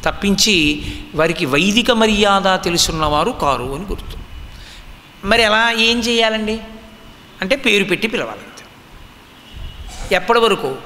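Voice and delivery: ordinary talk, nothing marked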